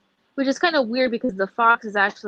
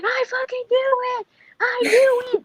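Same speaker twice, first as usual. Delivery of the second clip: high, cracking cry of triumph; excited yelling; 4 dB louder than usual. Retold or imitated. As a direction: shout it like you just called it. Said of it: "I was yelling".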